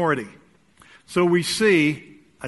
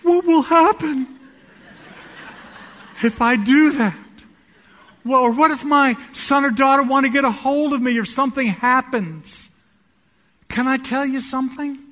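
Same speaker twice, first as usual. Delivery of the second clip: high, anxious mock worrier with a laugh; panicky objector; 8 semitones higher, 5 dB louder than usual. Preacher voicing a congregant panicking about a phone fast. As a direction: squeal like someone losing their phone.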